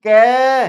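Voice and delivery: के held long on its vowel; drawn-out syllable. Shown as a demonstration. Teacher asking which sound ends it, के drawn out. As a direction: stretch के so the ending rings. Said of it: "last में किसकी sound आ रहा है".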